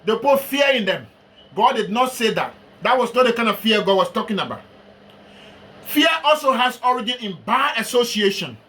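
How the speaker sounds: ordinary talk, nothing marked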